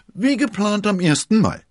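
high, loud servant's voice; excited report. A narrator's reading. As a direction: pipe it up eagerly at full volume. (aufgeregt, sehr hoch und laut)

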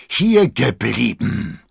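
loud, slow commanding roar; thundering. A narrator's reading. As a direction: thunder it out, high and drawn out. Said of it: "donnerte der Musketier". (donnernder Befehl)

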